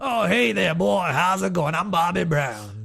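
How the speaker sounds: loud, goofy character greeting; hammed-up character accent. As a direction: introduce yourself in a drawling joke voice. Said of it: "in our [name] voice".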